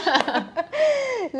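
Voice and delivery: very high-pitched laugh; real high, amused laughter. Real, not acted. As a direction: laugh freely at a shared joke. Shared joke.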